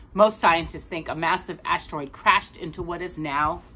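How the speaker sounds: ordinary delivery, nothing marked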